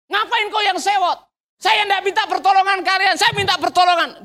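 high-pitched, heated comeback; indignant retort. Acted. Beggar answering the crowd back, indignant high retort.

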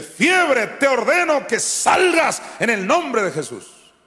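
high, loud shouted command, slowing down; commanding rebuke. Shown as a demonstration. Teaching believers to command fever in Jesus' name, shouting. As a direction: order the fever out with full force. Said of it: "Y tú dile".

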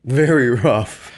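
slow, high, loud lisping echo; theological lisp voice. Acted. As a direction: lisp it out like a silly character. Echoing partner with a lisp.

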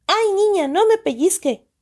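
high yelp of pain. A narrator's reading. Uncle pinched by the little girl, high yelp. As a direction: cry out at the pinch. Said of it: "gritó".

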